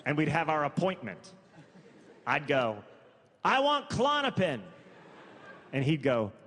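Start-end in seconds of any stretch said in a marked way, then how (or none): 3.4-4.6 much higher-pitched mock demand; high, acted patient voice; blunt patient request. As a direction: ask the doctor outright, voice pitched right up. Acted patient demanding pills from doctor.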